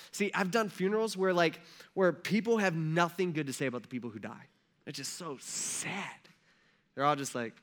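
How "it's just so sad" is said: ordinary talk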